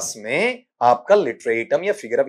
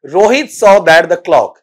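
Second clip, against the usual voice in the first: very slow, louder read of the question; slow reading aloud; 2 semitones higher, 8 dB louder, much slower than usual. Shown as a demonstration. Teacher reading the student's sentence aloud slowly. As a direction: read the line out slowly and clearly. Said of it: "Rohit ने देखा कि घड़ी".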